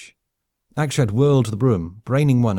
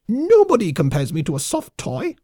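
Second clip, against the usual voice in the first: high, loud squeaky yell; yelling lemming warlord; 10 semitones higher, 4 dB louder than usual. Acted. Lemming colonel yells back at his captive, high furious squeak. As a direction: screech it back in outrage. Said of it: "Vok yelled back".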